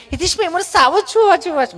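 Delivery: high, over-articulated mock city Gujarati; affected city speaker. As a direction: squeeze out each word in a high, prissy voice. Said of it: "ચીપી ચીપીને Gujarati બોલે".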